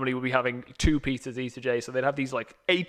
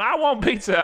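mock shout; 7 semitones higher, 4 dB louder than usual. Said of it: "women are shouting at them going".

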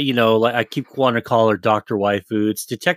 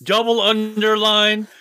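higher, laughing through a mock insult; laughing mock outrage; 8 semitones higher, slower than usual. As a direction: cackle out the insult like an angry note. Mock-reading an angry note, laughing.

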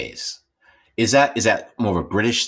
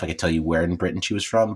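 normal talking voice

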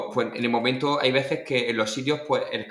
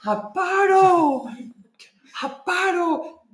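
very high, drawn-out yell; escalating strained calls, imitating his father; father calling out; 7 dB louder, much slower than usual. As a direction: shout the name urgently across a stage. Acts out father calling the dancer.

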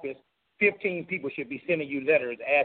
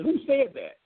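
governor asks; 12 semitones higher than usual. Retold or imitated. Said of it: "and he said".

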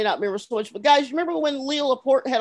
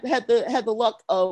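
normal talking voice

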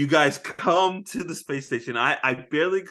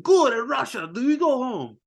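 put-on Russian accent; 8 semitones higher than usual. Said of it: "He's like".

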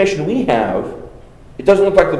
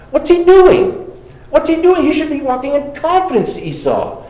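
higher, louder disbelief at Jacob; exasperated; 9 semitones higher, 3 dB louder than usual. Real, not acted. Preacher puzzled at Jacob's choice before the congregation, exasperated. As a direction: ask it twice in real exasperation.

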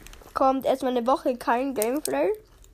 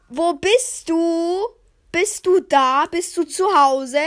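slow, calling mother, a bit higher and louder; drawn-out parent calling through the house; lightly imitating mom; 4 semitones higher, 5 dB louder, slower than usual. Son imitating his mother searching the house, slow calling. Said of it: "die Mutter kommt rein".

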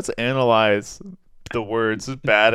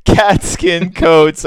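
laughing; 6 semitones higher, 8 dB louder, much slower than usual. Real, not acted. Laughing realization.

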